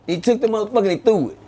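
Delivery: ordinary delivery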